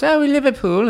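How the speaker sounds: high Scouse Beatles voice; Liverpool accent. Retold or imitated. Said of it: "I can always hear [name]".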